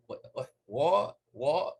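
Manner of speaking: exaggerated British accent, swallow the final T; mock British accent. Baffled by British speech in Harry Potter, mock British accent. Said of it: "They can't say T".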